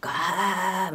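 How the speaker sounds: harsh slow throaty rasp; mock a strained gravelly try; imitating failed attempt. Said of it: "みたいな声だったんですよ".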